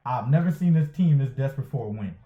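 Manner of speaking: ordinary delivery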